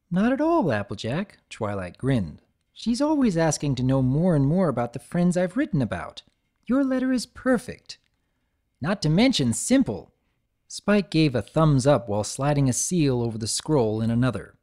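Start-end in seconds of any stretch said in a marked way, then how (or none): 0.1-10.1 high cheerful praise; bright and eager; lightly voiced chipper unicorn; Twilight voice.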